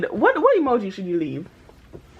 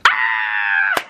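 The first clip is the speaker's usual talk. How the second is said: a real delighted shriek over the total; excited squeal. Real, not acted. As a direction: let out a thrilled scream-laugh. Reacting to grocery total.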